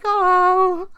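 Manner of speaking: high delighted gasp of oh; a startled little oh; surprised oh. Retold or imitated. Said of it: "and I'm like".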